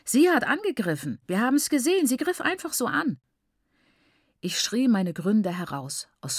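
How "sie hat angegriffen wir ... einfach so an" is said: high, insistent accusation; called out. A narrator's reading. Onlookers accusing the girl to teacher, calling out. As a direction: clamour like tattling children, pitch raised. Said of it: "riefen die anderen im Einklang".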